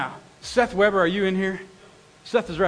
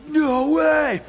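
amazed no way; 7 semitones higher, 3 dB louder than usual. Retold or imitated. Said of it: "in the end you're like".

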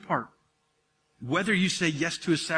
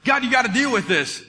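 mock angry prayer; 8 semitones higher, 6 dB louder than usual. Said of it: "so mad at him that I started praying".

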